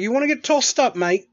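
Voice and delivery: playful British geezer taunt; British accent. Retold or imitated. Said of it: "talk to him in a British accent".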